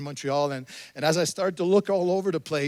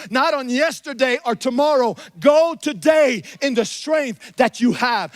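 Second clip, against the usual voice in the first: raised, ringing preacher's call, higher and louder; preaching at full force; 7 semitones higher, 6 dB louder than usual. Acted. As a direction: proclaim it to the whole church. Preacher exhorting the congregation.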